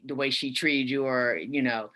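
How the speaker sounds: normal talking voice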